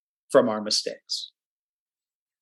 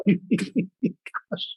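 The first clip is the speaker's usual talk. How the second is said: small laugh into a soft word; embarrassed laugh; 6 semitones higher, 5 dB quieter than usual. Real, not acted. Webinar host after being interrupted, laughing it off. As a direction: laugh it off quietly.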